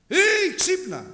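high, loud call to wake up; wake-up shout. Acted. Preacher acts out shouting to wake a sleeping soul, loud and high. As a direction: yell to rouse a sleeper. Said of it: "όσο και να φωνάξεις".

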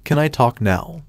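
ordinary delivery

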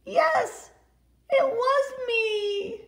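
put-on high, very slow tearful little boy; a small boy sobbing out his apology; David crying sorry. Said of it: "he always says".

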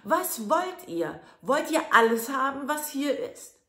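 high booming shout; shout again. Acted. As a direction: yell deliberately to wake the house. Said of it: "schrie von neuem".

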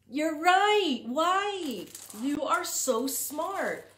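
gushing teacher praise; excited praise. Real, not acted. Praising children's answer.

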